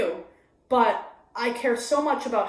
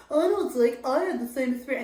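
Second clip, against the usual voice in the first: higher mock of a self-absorbed friend; annoying friend; 4 semitones higher than usual. Mocking the friend who always relates, higher voice. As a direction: play the friend who one-ups you. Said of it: "the friend that's annoyingly like".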